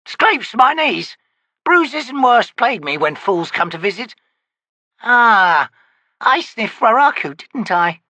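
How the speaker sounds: high, slow crone voice; old witch. Acted. Witch grumbling at her visitor, high crone voice. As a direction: croak it like a cranky hag.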